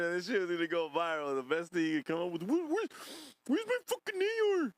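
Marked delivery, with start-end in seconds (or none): 2.4-4.7 high squealing imitation of the clip guy; mocking high squeak. Streamer mocking the viral clip, high squeal. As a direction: mimic his high whiny yell.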